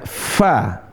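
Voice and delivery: "fa" with a long, airy f; angkat suaranya. Shown as a demonstration. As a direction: hold the f of "fa" before opening into the vowel. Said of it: "Angkat suaranya". ("fa" dengan f ditahan dan suara diangkat)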